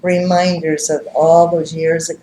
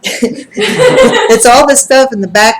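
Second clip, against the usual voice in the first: laughing; 8 semitones higher, 8 dB louder, slower than usual. Retold or imitated. Healer quoted, teller laughing.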